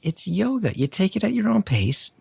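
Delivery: a hint of the easygoing wife. Wife voiced telling him relax.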